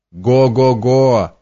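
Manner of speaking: slow, spelt-out mock guffaw; acted storybook guffaw, each beat stretched. A narrator's reading. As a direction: draw out a hearty ho-ho laugh. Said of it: "загоготал Васька".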